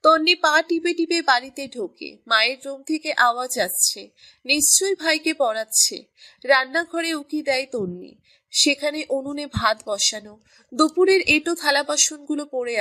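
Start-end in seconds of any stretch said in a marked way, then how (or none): none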